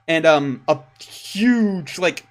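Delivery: ordinary talk, nothing marked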